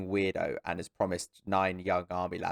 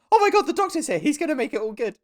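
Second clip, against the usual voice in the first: very high, loud hammed-up squeal; retold excited relief; 6 dB louder than usual. Viewer seeing the doctor turn up, squealed mock excitement. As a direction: gush it out high, like an overjoyed fan. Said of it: "I just went".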